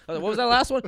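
ordinary delivery

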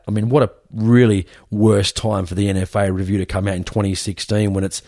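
ordinary delivery

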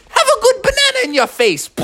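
very high, squealed character voice; gleeful attack. Acted. Monk Master's gleeful banana attack.

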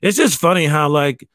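ordinary delivery

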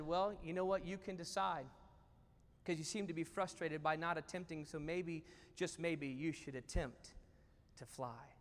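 low, soft, unhurried counsel; gentle nudge. Acted. Wise owl urges the eaglet to try flying, softly. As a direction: coax softly, leaving the choice to them.

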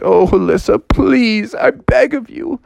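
high begging voice climbing to a squeak; father frog begging his daughter, high and whiny; high pleading father. Acted. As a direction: plead desperately with your daughter. Father begs Alyssa.

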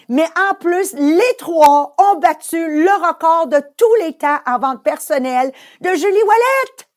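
high, loud, showy award announcement; booming prize announcer. Acted. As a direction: belt out the winners like a gala host.

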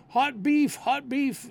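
high, lightly shouted street cry; mock hawker call. Police hears street cry, mild high hawker call. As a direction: call like a sandwich seller. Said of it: "Can you imagine a police hearing".

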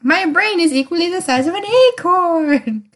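a half-hearted cartoon voice, tongue in cheek; silly put-on voice. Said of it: "I don't know why I'm talking like this".

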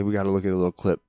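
ordinary talk

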